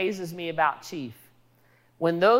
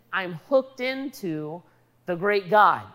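normal talking voice

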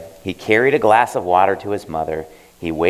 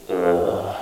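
a lazy morning groan. Acted. Boy groaning at being woken, a sleepy groan. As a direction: groan from the bed. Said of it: "and he groaned".

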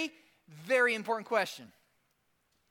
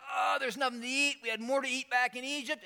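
higher, mock-whiny grumble; whining complaint; 4 semitones higher than usual. Preacher plays hungry Israelites in the wilderness, whining. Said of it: "And they're whining, they're complaining".

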